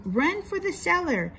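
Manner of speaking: aunt urging the girl to the cellar, hammed-up high cry; urgent cry. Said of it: "she screamed".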